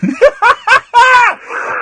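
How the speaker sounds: sudden high laugh out loud; burst of laughter. Real, not acted. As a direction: crack up at the joke. Laughs at caller's joke.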